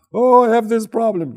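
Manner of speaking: high, loud mock child whine; whiny daughter. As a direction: squeal it like a fussy child. Daughter voiced.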